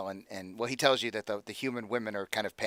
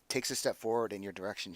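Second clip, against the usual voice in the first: usual speaking voice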